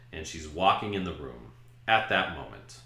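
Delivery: normal talking voice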